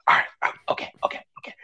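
hushed, breathy "all right, okay"; imitating dad whispering his agreement; whispered okays. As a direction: mouth the dad's reply in a whisper. Dad's whispered reply.

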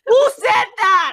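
shouted high and loud in disbelief; shocked outrage. Real, not acted. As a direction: blurt it out, appalled. Outraged at the customer's comment.

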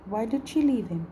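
ordinary delivery

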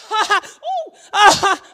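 high, loud nervous laugh with gasps; mock panicked giggle; laughing and panicking. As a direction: caught between laughing and crying. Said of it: "emotions going back and forth".

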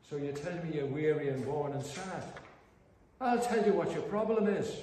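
imitated Irish brogue in full flow. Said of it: "in that lovely Irish brogue".

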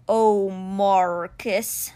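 very slow, drawn-out lament; grieving stone cheetah. Acted. Stone cheetah mourns his beloved creator, slow lament. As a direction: stretch the name out in grief.

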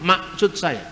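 "Maksud" said as "masut", the middle K dropped, Batak style; Batak accent. Shown as a demonstration. Lecturer imitating how Batak people say maksud, Batak accent. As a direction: swallow the K in "Maksud" like a Batak speaker. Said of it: "kan orang Batak nggak ngomong".

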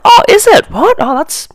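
high, loud fake innocent surprise; hammed-up gasp of fake surprise; mock surprise. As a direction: play dumb, all wide-eyed shock. Feigning innocence to ticket inspector.

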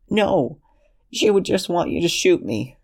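put-on high, pleading young man's voice; desperate Lexington.

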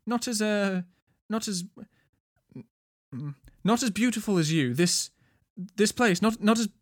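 high, halting, tongue-tied stammer; flustered blustering. A narrator's reading. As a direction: trip over your words trying to take back a compliment. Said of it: "Kilvir blustered".

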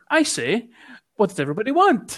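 put-on high, fast posh English accent; mock upper-class English accent. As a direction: ham up a toff English accent. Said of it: "stellar fucking English accent".

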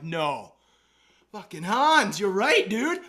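loud, high burst of relief; excited shout. Retold or imitated. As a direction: crow that Hans was right. Finds the club, exclaims.

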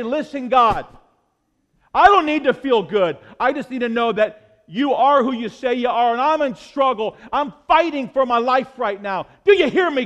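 loud, pleading cry to God; pleading prayer. Acted. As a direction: cry out to God as if fighting for your life. Preacher voices desperate prayer to God.

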